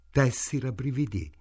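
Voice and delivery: ordinary delivery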